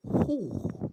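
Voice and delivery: high surprised oh; a real gasp of wonder; amazed oh. Real, not acted. Amazed by the building, high oh.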